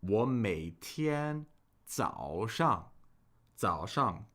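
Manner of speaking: very slow 我每天早上 with low dipping tones; slow tone demonstration. Shown as a demonstration. Teacher helping learners hear the tones, 早上 said slowly and clearly. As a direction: draw out every tone in 我每天早上. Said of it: "speak a little, uh, more slowly".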